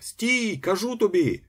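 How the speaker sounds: high, insistent call; bawling. A narrator's reading. Ostap repeating his order to the fugitive, high bawl. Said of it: "загорлав Остап".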